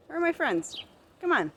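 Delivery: high coaxing call; calling chickens. Real, not acted. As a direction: coax the hens over, sing-song.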